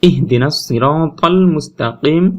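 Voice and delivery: slow, drawn-out chanted recitation; teacher reciting a Quran verse as an example. Shown as a demonstration. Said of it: "কোরআন শরীফে খুঁজে বের করি".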